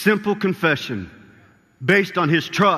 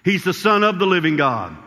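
rising sermon preaching; 3 semitones higher than usual. Acted. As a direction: preach it with growing conviction, punching the key words. Preaching builds to full force.

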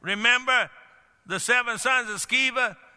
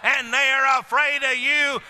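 full-force preaching; 3 semitones higher, slower than usual. Acted. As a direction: build it into a fiery cry.

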